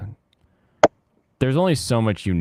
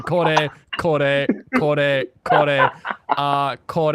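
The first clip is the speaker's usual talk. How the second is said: imitating a Japanese order; 7 semitones higher, 6 dB louder, much slower than usual. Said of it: "He's like".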